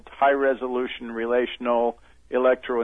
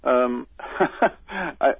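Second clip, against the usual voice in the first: breaks into a real laugh mid-answer. Real, not acted. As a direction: laugh before getting the words out. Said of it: "I'm sorry to laugh".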